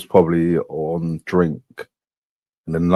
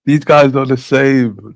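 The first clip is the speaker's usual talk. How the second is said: 1 exasperated exclaim; 8 semitones higher, 5 dB louder, slower than usual. Real, not acted. Exasperated verdict on the rivals.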